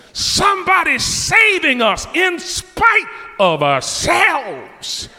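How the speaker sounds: high, loud, slow preacher's shout; fervent revival shout; preaching. Acted. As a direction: lift the room with the promise. Preaching at full force.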